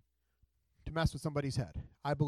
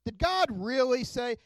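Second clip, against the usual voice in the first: higher and louder, slowed, still the serpent's taunt; sly snake echo; 6 semitones higher, 5 dB louder, slower than usual. Acted. Preacher repeating the snake's words, high and slow. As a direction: let the serpent's doubt hang. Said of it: "the snake says to Eve".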